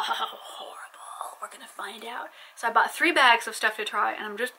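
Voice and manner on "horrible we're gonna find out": breathy mock-spooky whisper, drawn out. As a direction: hush it like a secret. Whispered suspense before tasting.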